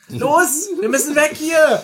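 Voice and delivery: high, loud call to flee; rally the gang at full voice; urgent shout. Acted. Drängt zur Flucht. (drängend und laut gerufen)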